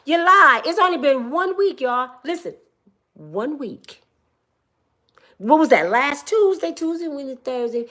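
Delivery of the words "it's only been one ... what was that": normal talking voice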